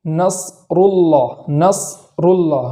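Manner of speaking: نصر اللہ drawn out, ص stopped before ر; slow careful Quran recitation of نصر اللہ; slow recitation demo. Shown as a demonstration. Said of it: "جیسے".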